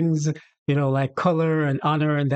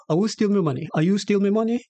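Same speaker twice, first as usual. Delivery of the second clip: patois, higher, rising on money; Jamaican patois accusation; 6 semitones higher, much faster than usual. Shown as a demonstration. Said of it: "that's how our conversation will go".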